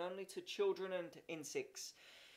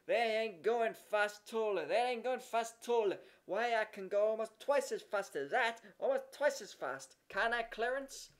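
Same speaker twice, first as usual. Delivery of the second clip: put-on loud, raised boyish brag; yelling boy; 4 semitones higher, 4 dB louder than usual. A narrator's reading. Jimmy yelling at Horace, loud boyish scorn. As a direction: shout him down like a jealous kid. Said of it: "he yelled".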